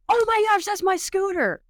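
high thrilled disbelief; excited shock. Real, not acted. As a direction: blurt it out, stunned to see it there. Spotting her stolen scooter.

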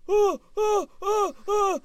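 high, loud bursts of "ah"; short yelps imitating a cramp; cries of pain. Husband acting out wife waking in pain, loud yelping.

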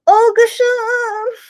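high, slow cry of a name; a mother crying out in alarm, drawn out; anguished cry. Retold or imitated. Mother running off, calling her son's name in alarm. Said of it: "She runs off and she's like".

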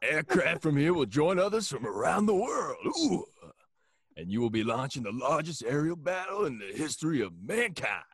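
loud, high rousing speech voice; rally the troops like a gung-ho general; Al Pacino. Pretend. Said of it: "That was Al Pacino".

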